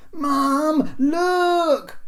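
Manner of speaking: put-on very high, loud, drawn-out child's call; insistent young boy. A narrator's reading. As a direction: pester mum at the top of your voice. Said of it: "insisted the boy".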